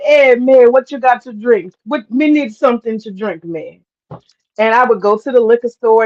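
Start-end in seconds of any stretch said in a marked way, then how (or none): 0.0-3.8 lightly imitating his Jamaican neighbor; Jamaican accent. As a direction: Jamaican lilt calling for a drink. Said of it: "You know, with the accent".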